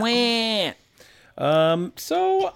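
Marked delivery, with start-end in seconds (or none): 0.0-0.7 high mock sob; fake-cry it high like a baby; mock crying wah. Hosts introducing the crying segment, mock wail. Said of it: "Boohoo moment".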